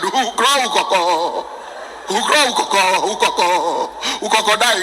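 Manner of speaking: mock chorus copying a stammer; imitated class chorus. Class repeats after stammering teacher, mimicked stutter. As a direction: chant the stuttered syllables back in rhythm. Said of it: "The whole class shouted".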